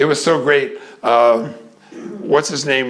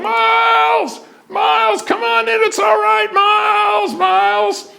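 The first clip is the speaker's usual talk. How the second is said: calling out. Retold or imitated. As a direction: call a lost child across the woods. Calling lost boy in dark forest.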